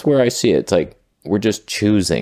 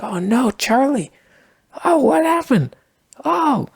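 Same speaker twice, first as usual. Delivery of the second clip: high wailing bison. Acted. Bison family wailing over dead Charlie in high mock grief. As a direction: wail it like a cartoon grieving mourner. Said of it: "It's like".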